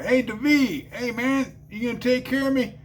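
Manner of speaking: high, impatient call; retold holler for the dealer. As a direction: holler toward the back room. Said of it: "so I hollered".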